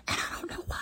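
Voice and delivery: whispered, baffled aside; exasperated aside. Real, not acted. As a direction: drop to a whisper in disbelief. Baffled aside about mixed-up names.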